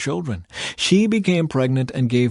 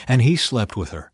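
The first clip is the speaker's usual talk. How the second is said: plain reading voice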